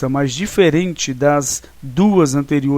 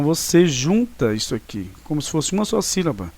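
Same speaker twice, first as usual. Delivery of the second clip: usual speaking voice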